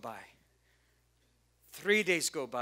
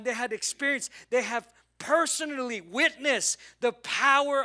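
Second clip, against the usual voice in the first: raised, loud, driving sermon voice; full-force preaching; 6 semitones higher, 6 dB louder than usual. Real, not acted. Preacher reminding the congregation what God has done, full-force preaching.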